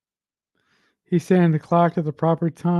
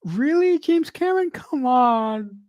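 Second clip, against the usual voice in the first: incredulous; 6 semitones higher than usual. Real, not acted. Protesting at the director.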